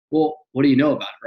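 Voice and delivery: ordinary talk, nothing marked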